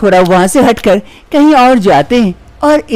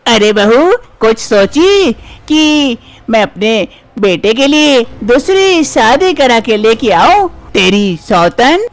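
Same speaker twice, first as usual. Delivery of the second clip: menacing dream mother-in-law; 3 semitones higher, slower than usual. Acted. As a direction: drag the threat out slowly like a nightmare.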